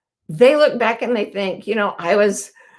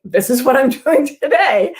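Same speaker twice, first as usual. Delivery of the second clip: laughing imitation of Jill; 5 semitones higher, 4 dB louder than usual. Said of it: "And she's like".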